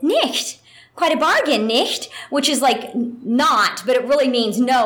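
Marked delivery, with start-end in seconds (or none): 0.0-2.1 high, teasing "Nicht" with a rising end; sassy anime girl adding "nicht"; German tag quip. Shown as a demonstration. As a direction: toss in a cheeky German "Nicht" and lift it. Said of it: "And I said".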